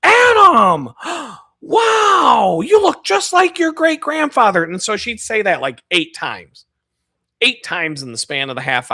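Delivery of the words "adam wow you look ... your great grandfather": put-on high shouted name, loud gasping old lady; excited old lady. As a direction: gasp and cry out like a thrilled grandma. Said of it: "She'd look back over at me".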